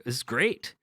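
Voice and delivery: ordinary delivery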